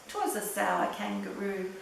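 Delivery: ordinary delivery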